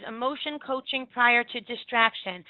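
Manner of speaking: normal talking voice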